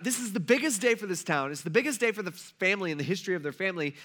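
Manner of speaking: ordinary delivery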